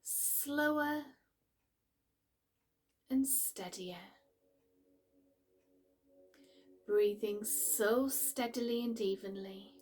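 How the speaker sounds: calm, unhurried meditation voice with long pauses; guided meditation. Acted. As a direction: gently guide the listener into relaxation, pausing between phrases.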